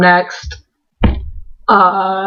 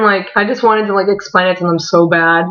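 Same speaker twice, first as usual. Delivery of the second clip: ordinary delivery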